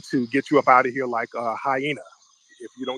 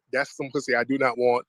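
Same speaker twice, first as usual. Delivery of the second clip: ordinary delivery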